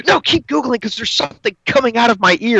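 wife urging him to keep searching; imitating wife. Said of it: "she's like".

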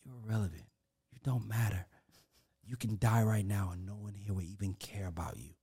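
soft, low, menacing hush; the show's star whispering to a ranting protester; cold whisper. Retold or imitated. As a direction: lean in and whisper it coldly. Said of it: "whispers to him".

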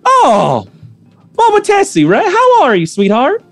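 high, bright-pitched motherly shopkeeper; shopkeeper Joanne's voice. Acted. Shopkeeper greeting the player character in a high voice. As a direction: coo at her like a fond auntie. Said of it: "and she goes".

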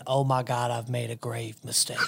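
inner thought. Retold or imitated. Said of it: "sitting there thinking".

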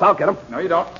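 sharp, high, loud cry; sudden shout. Acted. Blackie shouting at the gunman, sharp and loud. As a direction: yell it as the gun comes up.

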